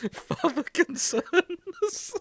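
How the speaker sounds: slow and high through laughter; laughing, drawn out. Real, not acted. Repeating her friend's joke, slow and laughing. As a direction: savour the phrase while cracking up.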